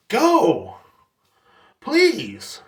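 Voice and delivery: high-pitched sarcastic send-off; exaggerated, drawn-up plea; mock urging. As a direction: beg them sarcastically to leave. Mock urging customer to leave.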